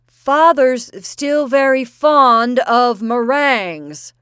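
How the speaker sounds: slow, high, loud yell; the boy bellowing into grandfather's ear. A narrator's reading. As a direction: yell each word slowly at a deaf man. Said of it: "Cyril leaned forward and yelled".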